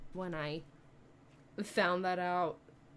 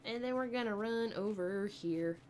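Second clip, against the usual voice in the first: normal talking voice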